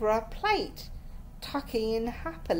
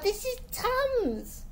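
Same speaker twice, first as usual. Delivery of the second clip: high, slow horrified protest; aghast, voice pitched way up; 12 semitones higher, slower than usual. A narrator's reading. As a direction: protest in horror, dragging it out. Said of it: "Esmeralda looked horrified".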